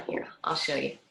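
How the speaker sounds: soft whispered aside. Real, not acted. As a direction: whisper it quietly to the student. Aside while demonstrating pose.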